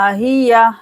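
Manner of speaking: normal talking voice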